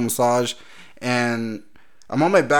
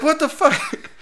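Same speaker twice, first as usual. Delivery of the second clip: shocked outburst; slower than usual. Retold or imitated. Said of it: "And I'm like".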